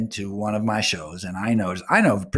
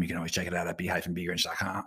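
rushed plug; 5 semitones lower, 10 dB quieter, faster than usual. Real, not acted. Plugging website, hurried aside.

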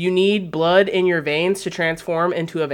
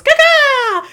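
shrill, very high, loud bat screech; bat call; 9 dB louder than usual. Retold or imitated. Morbius summoning his bats, very high loud screech. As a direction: shriek it like a summoning bat cry. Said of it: "And he goes".

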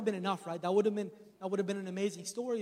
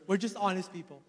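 ordinary talk, nothing marked

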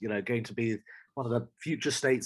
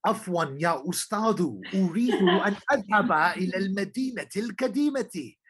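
formal Arabic; 6 semitones higher, 4 dB louder than usual. Retold or imitated. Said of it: "And I go".

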